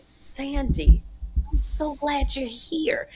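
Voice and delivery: high, drawn-out gush dropping to breathy; retold gushing greeting. Storyteller mimics him greeting Sandy, mock gushing. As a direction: gush the name, then slow into a breathy coo. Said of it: "and he's like".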